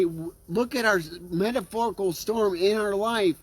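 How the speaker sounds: normal talking voice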